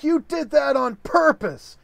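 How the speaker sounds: high, loud indignant yell; hammed-up outraged son; outraged accusation. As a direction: accuse your dad, betrayed and furious. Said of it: "He's like".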